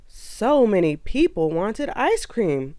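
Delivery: ordinary delivery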